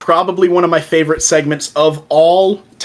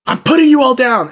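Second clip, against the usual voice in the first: shouting threat; 8 semitones higher than usual. Retold or imitated. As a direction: threaten them at the top of your voice. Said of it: "and he goes".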